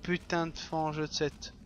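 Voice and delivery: ordinary talk, nothing marked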